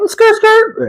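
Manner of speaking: very high-pitched tire squeal; imitated tire screech. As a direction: squeal it like skidding tires. Imitates tires screeching while pulling up.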